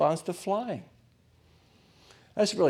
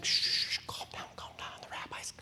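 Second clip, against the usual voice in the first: urgent hushed whisper; whisper, shushing restless kids; mothers hushing; 9 dB quieter than usual. Retold or imitated.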